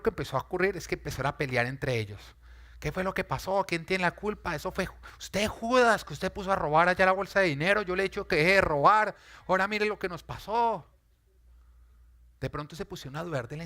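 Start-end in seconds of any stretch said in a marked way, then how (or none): 2.8-10.9 higher, loud blaming outburst; disciples quarreling. Acted. Disciple blaming Judas in the storm, loud and angry. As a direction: shout the blame at Judas.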